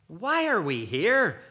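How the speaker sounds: put-on high, loud, slow child's question; puzzled child. Child to parents on arriving at the airport, puzzled. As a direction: ask like a baffled kid, drawn out.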